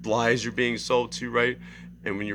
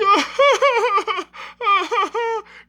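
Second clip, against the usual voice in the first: loud, high hysterical mock laughter; imitated crying laugh; 9 dB louder than usual. Imitating the man laughing at nothing, hysterical crying laugh. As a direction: laugh like the Joker while crying. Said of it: "Like he's crying at the same time".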